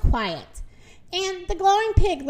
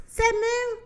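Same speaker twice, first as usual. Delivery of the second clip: ordinary delivery, nothing marked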